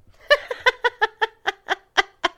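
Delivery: high bursting laugh out loud; sudden laugh. Real, not acted. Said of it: "I'm laughing".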